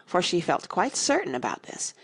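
plain reading voice

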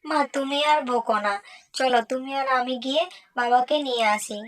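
put-on small, soft child's voice pleading with her mother; little daughter's voice. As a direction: coax mum gently, like a little girl. Narrator voices the young daughter.

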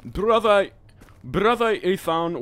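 loud, slow call for brother; drawn-out dramatic call. Acted. Calling out, then slow.

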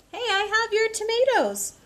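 high, loud cheerful call of hey; jolly truck driver. Acted. Truck driver calls out to the grocer, high and loud. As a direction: shout it across to the grocer, delighted. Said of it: "The truck driver told the grocer".

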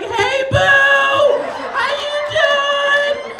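hammed-up sing-song flirt back at the stranger; sweet flirty reply. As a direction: coo it like you're suddenly smitten. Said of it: "it turned me sweet as shit instantly".

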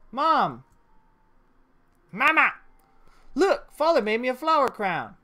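put-on eager girl running to her mother; happy daughter showing off her crown; excited little girl. Voicing the girl calling her mother.

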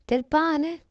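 ordinary delivery, nothing marked

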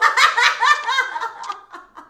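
high burst of laughter. Real, not acted. Streamer laughing at the guest's reply, high laughing burst.

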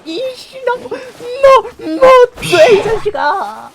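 slow, stretched furious scream; screaming rage. Acted. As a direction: scream it out in mock fury. Screaming at survivor.

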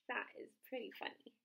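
plain reading voice

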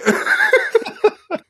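squealing laugh; high laugh. Real, not acted. Host cracking up at Herman's insult, high squeal. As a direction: burst into a high giggle.

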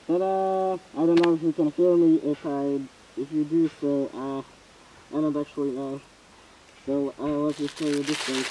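speaking inside rubber respirator; muffled through gas mask. Real, not acted. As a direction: voice behind mask filter. Seen 1: Full gas mask worn over face throughout the labelled words.

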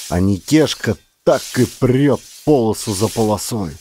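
ordinary delivery

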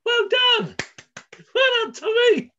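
high, loud, delighted praise; excited praise. Real, not acted. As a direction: cheer him on warmly. Praising child's correct answer.

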